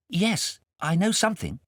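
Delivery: high, lightly voiced boy; young boy. Kay answering his guardian, a high boy's voice. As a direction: answer shyly as a small boy.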